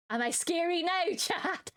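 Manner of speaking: squeaky high mock menace, laughing; scary voice. Streamer asking chat if she's scary, high mock menace. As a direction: attempt a scary voice and crack up. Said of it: "that's not the best I can do".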